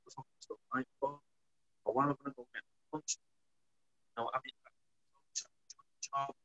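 muffled, like a finger over the mic; covered mic. Real, not acted. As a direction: sound cutting out behind a covered mic. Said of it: "I think you're covering the mic".

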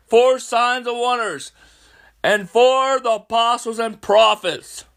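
high, loud fervent preacher voice; preaching at full force. Real, not acted. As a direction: proclaim it like a revival preacher.

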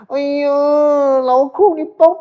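high, drawn-out pleading whine of a wife. Retold or imitated. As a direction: coax your husband in a long, sweet, slow whine. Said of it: "the woman".

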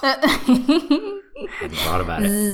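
high, slow laughing words; words squeezed out through laughter. Real, not acted. Laughing reply.

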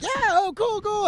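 spaced-out high voice, reeling; high on drugs. Retold or imitated. Comedian high at Coachella answering a fan, dazed and high-pitched. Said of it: "I was just rolling really hard".

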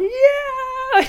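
very high, loud whoop; stoked yell. Retold or imitated. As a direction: whoop like you just saw a perfect wave. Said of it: "and Kelly's going".

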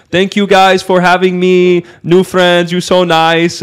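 retold toast in a put-on Russian accent. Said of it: "very strong Russian accent".

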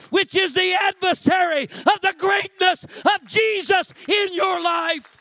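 high, loud pulpit shout; preaching at full force. Acted. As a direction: build to a full-throated sermon climax.

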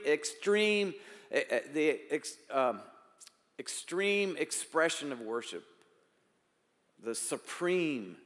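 normal talking voice

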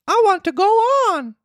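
high insistent puppet voice; insist, high and stubborn; stubborn Pinocchio. Acted.

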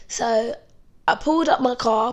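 normal talking voice

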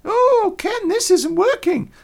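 high, slow, complaining wife voice; wife's voice. Retold or imitated. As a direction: play the frustrated wife, high-pitched. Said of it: "Heather picked the phone up and went".

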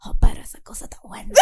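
put-on rural accent ASMR whisper. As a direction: whisper it like a country guy. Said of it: "como si fuera del interior".

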